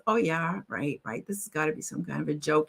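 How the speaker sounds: low, quiet dismissive aside; acted-out disbelief, low and soft; sceptical scoff. Doubting friend scoffs at claim.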